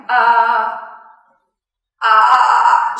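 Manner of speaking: loud pushed ah like lifting; straining under weight. Shown as a demonstration. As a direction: push as if lifting a heavy load. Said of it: "je porte cent cinquante kilos".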